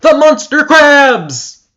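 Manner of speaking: high, loud, slow wailing scream; drawn-out shriek. Acted. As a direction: stretch the scream out in horror. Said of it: "she shrieked".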